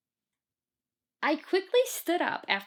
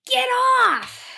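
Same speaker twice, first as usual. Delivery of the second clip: very high, loud shrieked get off; angry scream; 14 semitones higher, 11 dB louder than usual. Retold or imitated. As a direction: scream it at the kid on the blanket. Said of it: "She says".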